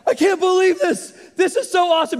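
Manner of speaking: put-on high, loud kid's thrill at the planes; excited young boy. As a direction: gush like an amazed little boy. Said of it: "I was just like".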